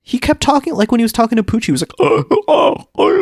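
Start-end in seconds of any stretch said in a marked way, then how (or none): 2.0-3.2 high strained choking gasps; short high-pitched choked gasps; imitated choking. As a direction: mimic someone choking for breath. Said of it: "Oh, like him choking".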